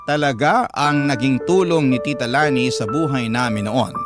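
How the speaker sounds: ordinary talk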